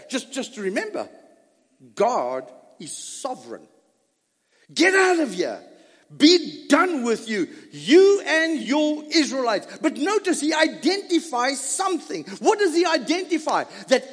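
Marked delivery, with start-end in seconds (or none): 4.7-9.7 a furious king ordering them gone; angry royal shout. Retold or imitated. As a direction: throw them out with a roar. Preacher voicing Pharaoh's dismissal.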